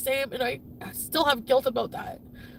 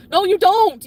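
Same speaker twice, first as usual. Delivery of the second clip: loud, high furious rebuttal; angry contradiction; 7 semitones higher, 11 dB louder, slower than usual. Real, not acted. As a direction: shout down the claim. Angrily answers partner's quoted declaration of love.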